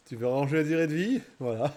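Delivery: ordinary delivery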